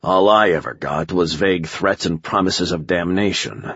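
usual speaking voice

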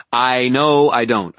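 loud, high, slow-hit angry retort; exasperated snap, each word leaned on; snapped. A narrator's reading. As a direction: snap back at her. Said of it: "I snapped".

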